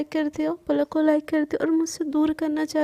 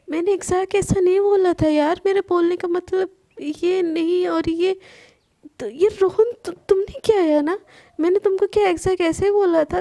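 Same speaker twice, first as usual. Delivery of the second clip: louder, higher and rushing, upset and defensive; an upset friend's rising loud protest; heated protest; 4 semitones higher, 5 dB louder than usual. A narrator's reading. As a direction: defend yourself hard, voice climbing.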